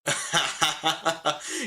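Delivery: a quick real laugh, rising; short laugh. Real, not acted. Streamer laughing at a player's wild new hair. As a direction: laugh it off lightly.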